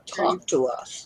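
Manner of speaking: drawn out, low and slow; low slow drawl. Acted. Teasing a silent guest.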